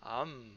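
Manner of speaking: "Am" with the doubled mem of the dagesh; "Am" said on its own, the mem held; pron. Shown as a demonstration. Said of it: "There's a dagesh in the mem".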